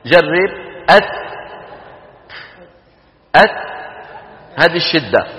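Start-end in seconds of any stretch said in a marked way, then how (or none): 0.9-3.6 أت closed hard, then a puff of breath; ta stop then breath. Shown as a demonstration. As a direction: let air out after the ta of أت. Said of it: "لما تقول أت". (أت بشدة ثم همس)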